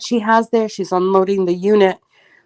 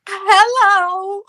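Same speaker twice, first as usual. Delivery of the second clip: bright hello; 11 semitones higher than usual. Retold or imitated. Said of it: "Now I feel like".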